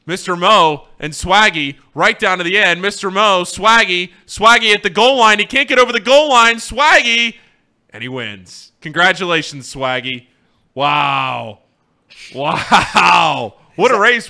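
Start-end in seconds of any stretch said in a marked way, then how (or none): 0.0-7.4 building race commentary, much higher and louder; rising sports call to the line; excited race call. Pretend. Mock race play-by-play. 8.8-14.3 hammed-up finish call; excited race call.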